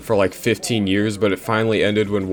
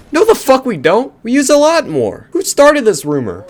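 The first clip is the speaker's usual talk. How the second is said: much higher, louder furious yell; angry shout; 14 semitones higher, 6 dB louder than usual. Real, not acted. Vlogger shouting at a brain myth, angry yell.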